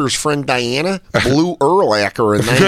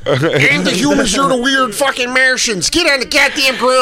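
mock cop shout; 8 semitones higher than usual. Pretend cop yelling at the suspect to get down. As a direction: yell like a cop on a raid.

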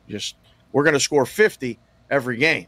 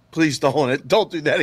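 laughing; 4 semitones higher than usual. Real, not acted. Laughing at the accent.

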